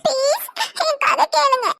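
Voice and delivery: put-on a small boy pleading not to eat, half sobbing; whining child. Child voice continues. (சாப்பிட மாட்டேன் என்று அழும் சிறுவன் குரல்)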